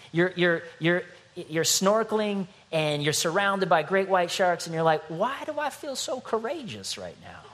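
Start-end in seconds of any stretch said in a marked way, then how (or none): 5.1-7.4 much higher, puzzled, playful; a snorkeler puzzled by his own calm; squeaky mock surprise. Preacher acting out a snorkeler among sharks, with high mock surprise.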